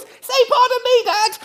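very high, squeaky imitation of a small boy; little child's voice. Son corrects dad after a burp, high little voice. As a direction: pipe up as a tiny child correcting a parent. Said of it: "you'll hear this little voice".

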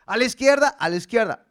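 loud call of "A la izquierda"; calling commands. Retold or imitated. Preacher calling out to the ox, loud and firm. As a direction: shout "a la izquierda" to steer the ox.

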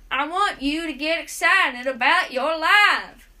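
loud preachy TV host voice; Dr. Phil. Retold or imitated. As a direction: lecture like a daytime TV doctor. Repeats the Doctor Phil impression.

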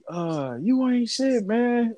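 slow, high mock of his girlfriend; girlfriend's insult. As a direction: drawl it out slowly like her. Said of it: "She like".

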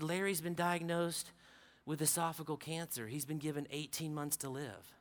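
soft, low, hushed telling; hushed bad news. Neighbor's wife tells the preacher of the cancer, hushed. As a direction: deliver the news of the cancer quietly. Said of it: "She said".

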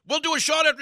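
high, loud imitated South African accent. Rocker offering his host a shot backstage, imitated South African accent. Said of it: "thick, like, you know, South African accent going".

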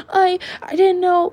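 high, breathless panicked squeak; panicked gasp. Retold or imitated. Fourth grader gasping to her teacher, high panicked voice.

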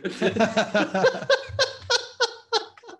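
long, high, loud laughing fit; real high-pitched belly laugh; helpless laughter. Real, not acted. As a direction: crack up completely. Cracking up at the joke.

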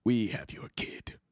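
low whispered villain threat; menacing whisper. Shown as a demonstration. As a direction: hiss the threat softly. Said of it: "Let me try again".

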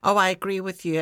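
normal talking voice

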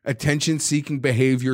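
ordinary talk, nothing marked

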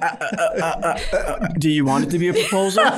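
high, laughing mock panic; stumble, then rush out the question; flustered stammer. Acting his flustered stammer at question.

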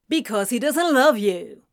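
loud, cutting retort; snapped. A narrator's reading. Friend attacks the engagement, snapping. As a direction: snap it back at him. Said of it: "Gabriel snapped".

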